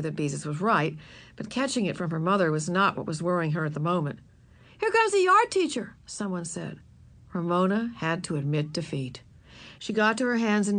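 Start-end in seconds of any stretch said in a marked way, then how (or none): 4.8-6.0 very high, loud warning; calling out. A narrator's reading. Child warning the crowd of the teacher, calling out. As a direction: raise the alarm to the crowd.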